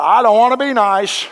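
put-on whiny, high, loud child voice; petulant refusal. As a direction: pout it out like a stubborn kid. Telling God he refuses.

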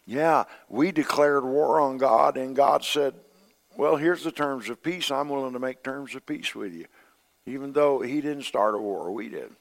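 preacher reaching the climax; impassioned preaching. Acted. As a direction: lift the congregation with awe.

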